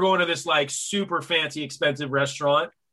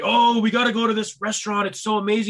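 higher-pitched, gushing imitation of friends; excited friends; 6 semitones higher, faster than usual. Friends urging a restaurant visit, excited imitation. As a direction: mimic their eager foodie enthusiasm. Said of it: "they're all excited".

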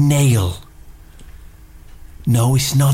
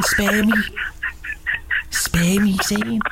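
psychic whisper; 9 semitones higher, 3 dB quieter, slower than usual. Acted. Psychic summoning again.

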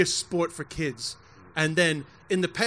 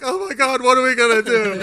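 higher, louder mock wail; imitated crying mother; 6 semitones higher, 6 dB louder than usual. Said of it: "she started crying again".

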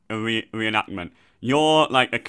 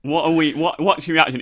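ordinary talk

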